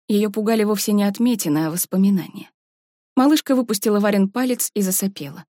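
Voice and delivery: ordinary delivery, nothing marked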